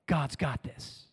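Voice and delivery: soft, slow, reassuring; quiet assurance. Acted. Preacher softly reassuring the church. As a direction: settle it gently. Refrain after prayer point.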